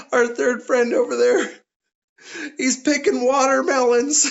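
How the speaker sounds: high, acted tearful laughing voice; holding in laughter. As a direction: squeeze it out through mock helpless laughter. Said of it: "trying to hold in their laughter".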